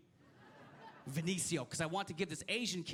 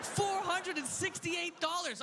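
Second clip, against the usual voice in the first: mock outrage; 10 semitones higher, slower than usual. Outraged at the price.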